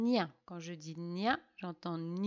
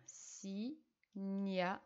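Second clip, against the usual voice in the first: "Si-nia-tu-re" said very slowly, each of the four syllables separated; teacher spelling out "Si-nia-tu-re" slowly for the pupils; 6 dB quieter, much slower than usual. Shown as a demonstration. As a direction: count out "Si-nia-tu-re" one syllable at a time. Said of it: "quatre syllabes à écrire".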